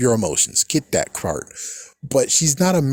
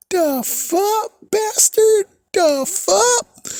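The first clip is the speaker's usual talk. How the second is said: squeaky outraged disbelief, far higher; shrill disbelief; much slower than usual. Real, not acted. Vlogger reacting to Gabriel's plan, shrieking in disbelief.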